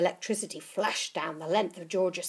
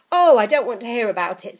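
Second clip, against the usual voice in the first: put-on louder, fast upset boy; 5 semitones higher, 14 dB louder, much faster than usual. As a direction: blurt it out in protest. Boy protesting.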